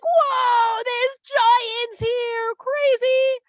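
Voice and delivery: high, loud, drawn-out whoa of astonishment; amazed spies. Acted. Spies stumbling on a family of giants, amazed. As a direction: cry it out slowly in hammed-up shock.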